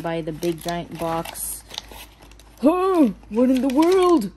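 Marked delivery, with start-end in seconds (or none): 2.6-4.3 loud, high astonished outburst; baffled surprise. Real, not acted. Finding an unexpected piece, baffled surprise.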